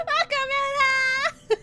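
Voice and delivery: high, loud and slow through laughter; laughing welcome. Real, not acted. Streamer welcoming a viewer, laughing shout. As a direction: shout the welcome while cracking up.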